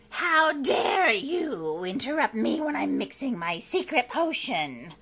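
loud, indignant witch voice; screeching witch. Acted. Witch interrupted mixing a potion, screeching. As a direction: scold like an outraged witch. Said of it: "screeched the witch".

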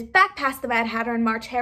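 plain reading voice

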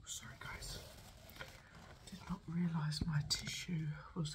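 ASMR review whisper; hushed honest verdict. Real, not acted.

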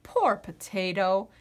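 ordinary delivery

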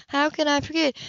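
sarcastic echo of his phone talk; mocking Hannah. Hannah mimics then warns.

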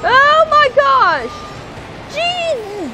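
high astonished cries. Real, not acted. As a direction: gasp at the madness. Astonished at chaos.

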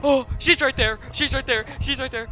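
alarmed. Real, not acted. Monster in sight.

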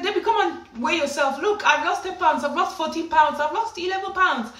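high, eager chatter of boasting friends; excited fellow patients. Retold or imitated. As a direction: imitate excited mates egging her on. Excited mates urging at the scale.

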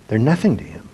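usual speaking voice

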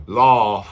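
loud "laugh" with back AW vowel; wrong back vowel. Shown as a demonstration. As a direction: laugh said as "loff", rounded AW.

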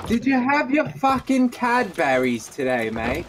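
high, loud hammed-up Australian accent; mock Australian accent. Teasing a crewmate about Cadburys in a mock Australian accent. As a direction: ham up an Aussie bloke, Australian accent. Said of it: "you look like an Australian".

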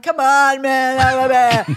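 high, loud retold pleading; mock coaxing. Comic nagging Nate to have a beer, hammed-up coaxing.

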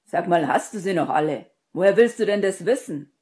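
very loud, exasperated yelling; irritated, high-volume rebuke; annoyed outburst. A narrator's reading. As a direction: shout in disbelief at a friend. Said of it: "Ron verdrehte genervt die Augen".

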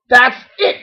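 high yell from a fed-up mom. A narrator's reading. Mother duck laying down the law, yelling. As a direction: yell to put an end to it. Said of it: "yelled their mother".

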